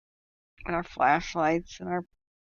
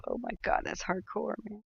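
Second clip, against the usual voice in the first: hushed awe; 3 semitones higher, 8 dB quieter than usual. Real, not acted. Reacting to bear punching story.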